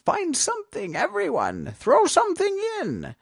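high, gleeful witch; said with a grin. A narrator's reading. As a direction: call everyone to join in merrily. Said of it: "said with a grin".

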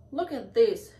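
loud, excited call; crying out. A narrator's reading. Mermaid showing friends a find, crying out. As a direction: call a friend over to see. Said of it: "she cried".

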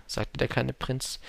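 plain reading voice

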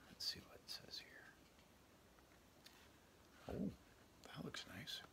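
soft unvoiced aside; hushed aside to the page; whispered aside. Real, not acted. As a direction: whisper to yourself while looking. Reader aside while looking.

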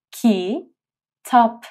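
کتاب broken into کی and تاپ; syllable split. Shown as a demonstration. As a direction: say کی then تاپ apart. (کتاب هجا به هجا)

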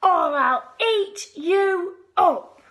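loud, high, slow growling threat; little brother threatens the monsters loudly; shouted threat. Acted. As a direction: scare the creatures off with a threat. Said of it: "shouted Lewis".

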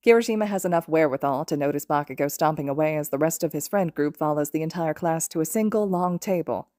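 ordinary delivery

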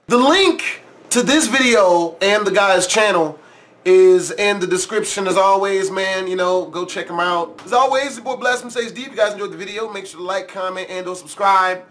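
low, steady sign-off read; outro plug. Acted. YouTuber tells viewers to like and subscribe, calm outro read. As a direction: wrap up the video for subscribers.

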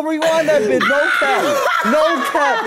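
high laughing yell; hyped, laughing call to rewind; laughing shout. Real, not acted. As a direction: shout it while cracking up. Hyped yelling at the clip.

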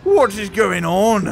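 slow, loud mock Scottish accent; drunk Sean Connery. Player baffled by the game, slow loud brogue accent. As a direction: draw it out in a put-on brogue accent. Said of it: "I sound like a drunk Sean Connery".

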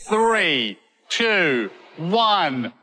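high, building countdown; excited countdown. Real, not acted. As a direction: count down to the blast. Explosion countdown.

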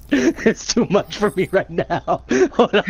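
giggling through the words; can't stop laughing while talking. Real, not acted. Laughing fit before a countdown.

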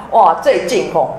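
ordinary talk

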